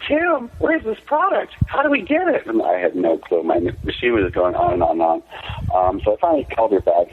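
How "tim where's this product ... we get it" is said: high, put-on voice of an urgent mom; mock-urgent mother on the phone, high-pitched; imitated mom. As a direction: mimic mom way up high, demanding answers. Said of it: "with my mom going".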